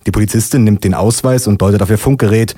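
usual speaking voice

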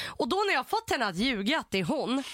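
ordinary delivery

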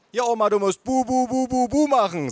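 high singsong chant; baby-talking relative. Acted. Relative demonstrating baby talk.

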